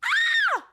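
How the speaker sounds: loud, high-pitched "ah!"; sudden high yell. Pretend. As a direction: let out a piercing scream. Sudden yelp after being goaded.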